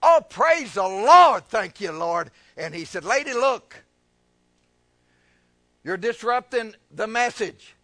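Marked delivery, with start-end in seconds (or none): 0.0-2.3 big, slow, loud outburst, then easing; imitating fervent praise. Lady interrupting a third time, mimicked fervent praise. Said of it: "the word hit her again". 3.0-7.8 raised, halting reprimand; imitated scolding. Revival preacher to disruptive lady, imitated scolding.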